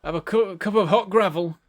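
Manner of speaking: mock his northern family in thick Yorkshire; Yorkshire accent. Streamer jokes about his northern family, hammed-up Yorkshire accent. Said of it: "That's Yorkshire".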